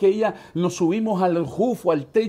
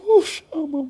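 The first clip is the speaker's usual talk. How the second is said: voice breaking; 10 semitones higher, 6 dB louder than usual. Real, not acted. Breaks down recalling wife's despair.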